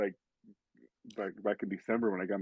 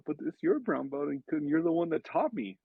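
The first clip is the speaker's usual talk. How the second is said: higher-pitched imitation of an earnest kid; pleading kid; 7 semitones higher than usual. Kid protests to the mentor who taught him, pleading. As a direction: plead like a young student with his teacher.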